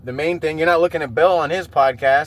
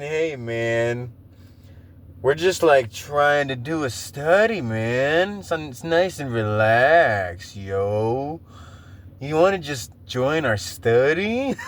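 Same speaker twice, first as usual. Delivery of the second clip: [name] voice; 2 semitones lower, much slower than usual. Retold or imitated. Imagining the podcast guest as [name], slow stoner drawl. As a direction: drift through it like a relaxed stoner. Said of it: "[name] kinda voice".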